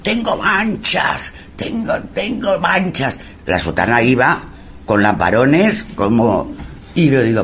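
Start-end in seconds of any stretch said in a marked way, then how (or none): 0.0-3.2 high, slow, anguished lament; mock possessed wailing. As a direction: imitate a tormented soul crying out. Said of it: "Aldo gritando esas barbaridades, diciendo".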